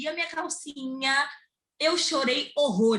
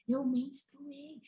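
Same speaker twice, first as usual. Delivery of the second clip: very soft, drawn-out admission; retold in a tiny hushed voice; hushed confession; 2 semitones higher, 9 dB quieter, much slower than usual. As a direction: confess it under your breath, dragging the words. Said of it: "Eu falando baixinho".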